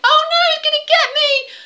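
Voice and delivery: very high, loud terrified squeal; panicked shriek. Acted. Country mouse chased by the cat, shrieking in panic. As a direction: shriek as the claws swipe.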